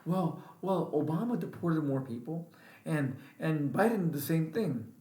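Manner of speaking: lightly mock their whiny excuses; mocking Trump supporter. Mimicking Trump supporters' social media excuses, mocking. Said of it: "I'm seeing it all over the social media world".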